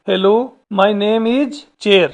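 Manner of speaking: very slow, each word set apart; slow model reading. Shown as a demonstration. Teacher modelling the chair's sentence for students, very slow. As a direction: say it slowly so children can copy. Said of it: "पहले इसको पढ़ना है".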